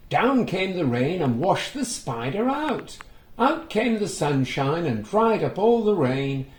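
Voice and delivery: sing-song nursery rhyme reading; rhyme recital. A narrator's reading. Reading the rhyme to children, sing-song.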